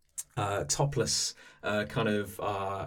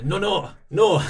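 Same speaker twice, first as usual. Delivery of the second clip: put-on high, loud, over-the-top French accent shouting; outrageous French accent; 10 semitones higher, 10 dB louder than usual. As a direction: bellow it in a hammy French accent. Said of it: "an outrageous, uh, French accent".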